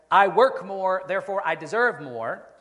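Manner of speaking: loud, emphatic voice of entitlement; entitled claim. Acted. Preacher voicing the entitlement trap to the congregation, loud entitled claim. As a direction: stake the claim forcefully. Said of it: "if the entitlement trap says".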